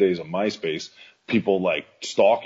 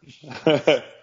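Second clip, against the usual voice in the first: loud laugh; 6 semitones higher, 6 dB louder than usual. Real, not acted. Laughs after the story.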